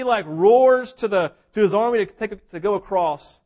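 ordinary talk, nothing marked

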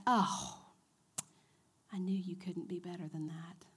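whispered taunt. Retold or imitated. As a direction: breathe it out like the accuser. Enemy's voice whispered.

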